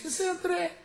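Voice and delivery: very high little voice from the back; tiny drunken voice. Acted. Drunk at back of bar butts in, tiny voice. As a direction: pipe up in a thin, high voice. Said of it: "se siente de atrás del fondo del bar una vocecita".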